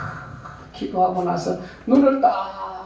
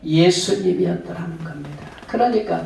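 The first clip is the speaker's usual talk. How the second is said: ordinary talk, nothing marked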